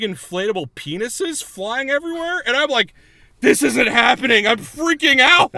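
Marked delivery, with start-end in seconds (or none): 3.4-5.5 high, loud mock freak-out; hammed-up panicked outburst; retold panic. High woman retelling her panic at the concert, loud mock panic.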